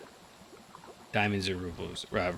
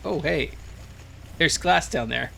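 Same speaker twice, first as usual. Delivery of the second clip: relieved excitement; 7 semitones higher, 4 dB louder than usual. Real, not acted. Relief after scare.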